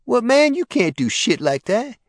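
high, loud, whiny protest; a scared hustler pushing back; high loud indignant whine; protesting dealer. Acted.

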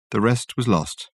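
ordinary delivery, nothing marked

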